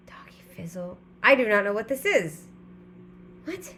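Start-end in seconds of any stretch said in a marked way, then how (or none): 0.1-1.0 whispering the name to herself. Real, not acted. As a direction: breathe the words out with no voice. Reading odd show name aloud.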